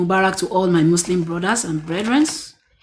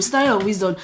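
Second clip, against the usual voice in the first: ordinary talk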